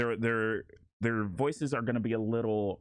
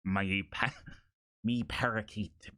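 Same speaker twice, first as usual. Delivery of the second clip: light pirate voice; 3 semitones lower than usual. Shown as a demonstration. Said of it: "actual pirate talk".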